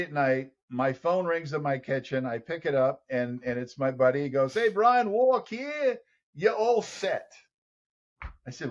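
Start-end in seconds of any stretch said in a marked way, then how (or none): 4.5-7.3 put-on Australian accent. Phoning late at night with the news, Australian accent. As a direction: announce it like a proud Aussie mate. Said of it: "this wild man talking to me".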